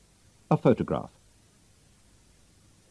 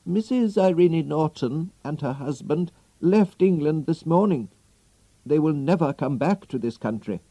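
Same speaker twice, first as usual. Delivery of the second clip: put-on old servant; 6 semitones higher than usual. Servant delivers the news.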